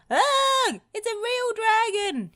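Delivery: very high, loud panicked shriek; terrified cry. A narrator's reading. Spud cries out in terror at the dragon, panicked. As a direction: shriek in pure fright. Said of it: "he cried".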